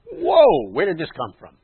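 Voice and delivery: a high, loud "Whoa" that settles back into the question; mock surprise. Preacher voicing a puzzled listener's question, high mock surprise. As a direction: play the startled listener on "Whoa".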